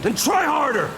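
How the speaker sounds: high, sharp demand; angry outburst. Acted. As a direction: push him to finally tell the truth. Bruce snaps at Alfred.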